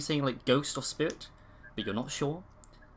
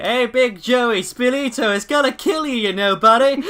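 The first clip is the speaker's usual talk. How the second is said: hammy Italian-American tough guy from Jersey; Italian-American accent; 11 semitones higher, 9 dB louder than usual. Acted. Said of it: "I can't do an Italian accent".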